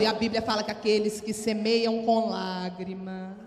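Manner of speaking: usual speaking voice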